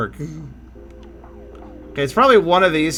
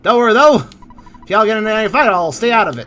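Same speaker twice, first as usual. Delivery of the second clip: put-on Texan accent; 3 semitones higher, 3 dB louder than usual. Gamer voicing the invisible man's offer in a louder hammed-up Texan accent. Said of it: "everybody is Texan".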